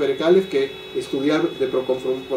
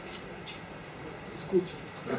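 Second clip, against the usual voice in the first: soft whispered plea to listen; whispered urging; 2 semitones higher, 18 dB quieter, slower than usual. Acted. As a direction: breathe the word escucha, barely voiced. Exhorta a escuchar. (susurrando: escucha)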